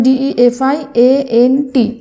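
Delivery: slow, separate letters; dictating the spelling slowly. Shown as a demonstration. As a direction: spell it out one letter at a time. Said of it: "আমি দেখো তোমাদের উচ্চারণ করে ভেঙে ভেঙে".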